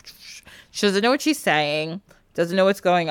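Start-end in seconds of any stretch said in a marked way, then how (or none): 0.1-0.4 very soft voiceless hush; whispering under breath. Retold or imitated. Stepmother muttering so the girl can't hear, faint whisper. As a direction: imitate secretive whispering without real words. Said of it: "whispering under her breath".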